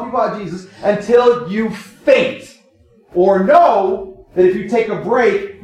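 fervent preacher stacking commands; preaching at full force. Acted. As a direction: pound out each "don't" like a drumbeat.

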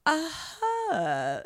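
high, drawn-out imitation of an odd accent attempt; Tommy Wiseau. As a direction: lightly mimic a bad American accent attempt. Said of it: "he goes".

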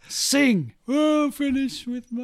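high, put-on [name] voice; [name] impression. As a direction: squawk it like [name] mid-gig. Imitating [name] on stage.

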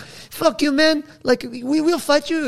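high mock German hipster; German accent. Skinny German hipsters challenge big aggressor, German accent. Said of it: "skinny hipster German dudes".

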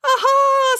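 loud, very high exclamation; drawn-out cry of discovery; triumphant aha. Acted. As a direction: crow it in sudden triumph. Triumphant realisation in story.

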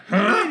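silly high huh. Retold or imitated. Predator's noise again.

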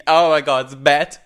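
ordinary talk, nothing marked

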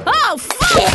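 pained yell. Acted. Slapped with microphone.

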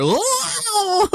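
high, drawn-out mock "whoa" wail; falling overboard cry. Recalling a man going overboard, mock falling wail. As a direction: wail it like someone toppling over a railing.

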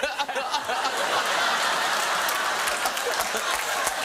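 long breathy high laugh. Real, not acted. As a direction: dissolve into laughter. Laughing at Ewan.

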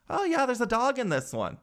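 high-pitched airy lady voice; lightly imitated realtor. Lady tells her clients about the dog, imitated realtor.

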